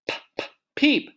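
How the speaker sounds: high, quick p, p, peep; P, p, peep, bouncing up; p sounds into peep. Shown as a demonstration. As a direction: pop the p's then peep. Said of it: "has the p sound".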